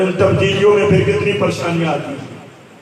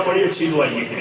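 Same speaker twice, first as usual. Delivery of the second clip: sarcastic grumble; 6 semitones lower than usual. Retold or imitated. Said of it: "بابا جی کو پہلے غصہ ہے".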